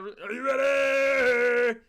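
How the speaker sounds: drawn-out arena hype yell; hype announcer shout. Acted. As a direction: call it out like a ring announcer. Hyping up chat before game.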